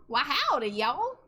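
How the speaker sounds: big, high Southern cowboy greeting; Southern yeehaw voice. Pretend. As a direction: put on a loud Southern twang. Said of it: "It's yeehaw voice time".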